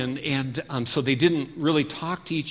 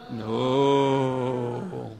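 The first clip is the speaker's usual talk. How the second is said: very long drawn-out no; kids' chorus answer; 4 semitones lower than usual. Shown as a demonstration. Teacher answers for the kids, long chanted no. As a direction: hold the no out like a class chorus.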